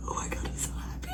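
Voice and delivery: breathy whispered happiness; whispered joy. Real, not acted. Reader overjoyed by a scene, whispered joy.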